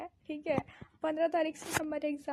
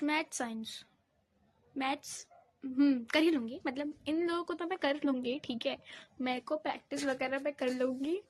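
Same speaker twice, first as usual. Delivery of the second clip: ordinary delivery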